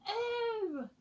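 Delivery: high, loud startled Oh; startled cry. Acted. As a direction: jump at the owl landing beside you. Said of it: "cried the little boy".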